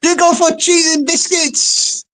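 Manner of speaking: high-pitched lady's voice; Missus John O'Groats. Acted. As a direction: repeat it high, as the innocent lady. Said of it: "Missus John O'Groats says".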